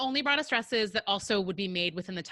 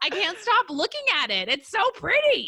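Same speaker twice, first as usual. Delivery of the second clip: high, loud gushing over the dress; giddy and much higher; 9 semitones higher, 6 dB louder than usual. Real, not acted. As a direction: swoon over how pretty it is. Gushing over her wedding dress.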